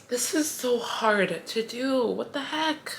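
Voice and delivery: high, strained voice cracking with real tears; fighting tears. Real, not acted. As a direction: push the words out while trying not to cry. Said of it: "I'm trying so hard not to cry".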